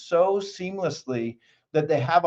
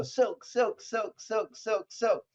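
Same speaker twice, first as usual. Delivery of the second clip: fast silk repetition; 4 semitones higher, 5 dB quieter, much slower than usual. Retold or imitated. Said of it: "And they go".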